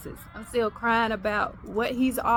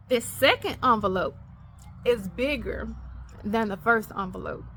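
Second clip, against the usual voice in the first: usual speaking voice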